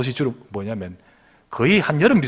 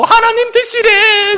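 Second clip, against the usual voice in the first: mocking kids; 11 dB louder, slower than usual. 그러면서 막 웃고.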